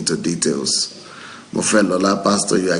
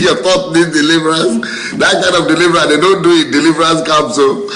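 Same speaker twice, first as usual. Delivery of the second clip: higher, louder excited riff; 8 semitones higher, 5 dB louder than usual. Real, not acted. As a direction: pitch it up and push, amused and fired up. Amused rant about deliverance.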